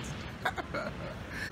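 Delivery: short airy laugh. Real, not acted. Laughs after smiling at agent.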